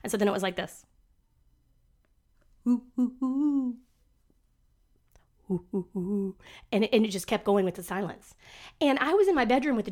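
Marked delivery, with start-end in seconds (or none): 2.7-6.3 awkward hum. Retold or imitated. Imitating awkward Zoom silence.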